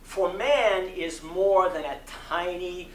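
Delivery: measured preaching cadence with rising emphasis; sermon reading. Acted. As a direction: deliver it from the pulpit, building conviction. Sermon continues after response.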